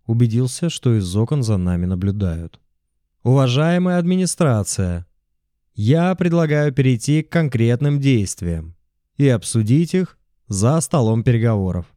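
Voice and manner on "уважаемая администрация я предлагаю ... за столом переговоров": formal address, raised voice; rally speech. A narrator's reading. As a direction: speak up to the building. Speech to administration by megaphone.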